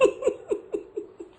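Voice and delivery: high, breathy run of laughter; mocking laughter. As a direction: laugh helplessly at a naive friend. Wife laughs at friend.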